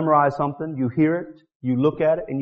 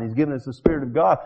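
ordinary delivery

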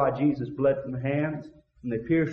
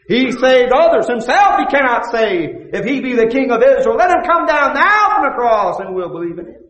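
mocking; 10 semitones higher, 11 dB louder than usual. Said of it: "They mocked too".

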